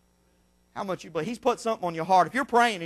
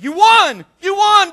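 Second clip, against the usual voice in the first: high, loud excited cries; a nurse bursting with news; 16 dB louder, slower than usual. Retold or imitated. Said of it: "Boy, she's just excited".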